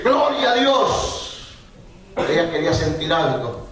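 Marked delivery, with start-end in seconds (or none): 0.0-1.6 high, slow, drawn-out praise cry; sister praising aloud. Retold or imitated. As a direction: stretch out "Gloria a Dios" high and slow like a fervent church sister. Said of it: "una hermana comenzó a decir". (alabanza alta y lenta de hermana fervorosa)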